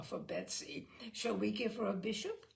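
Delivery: ordinary delivery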